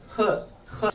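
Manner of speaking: ख as a scraped "kha" from the throat; throaty kh. Shown as a demonstration. Teacher modelling the letter for learners, throaty ख. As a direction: say each ख separately, rasping at the back of the throat. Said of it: "इसकी आवाज भी हलक से आएगी".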